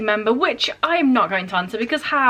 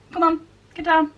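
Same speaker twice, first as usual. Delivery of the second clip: higher, gently urging call to a pet; coaxing the cat; 5 semitones higher than usual. Real, not acted. Asking the cat to get down, lifted coaxing call.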